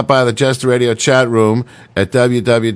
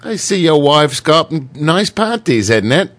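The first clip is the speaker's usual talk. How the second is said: put-on British voice, pitched up; British accent; 4 semitones higher than usual. Shown as a demonstration. Joking with the British phrase, put-on British accent. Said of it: "The British".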